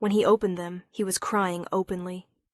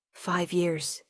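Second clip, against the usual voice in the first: ordinary delivery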